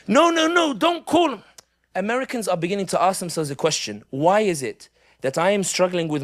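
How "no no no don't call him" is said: high, loud frantic "no no no"; imitating friends frightened of an ambulance bill, high and loud; panicked pleading. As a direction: beg them not to call anyone, in a high panic. Friend panics again after vomiting.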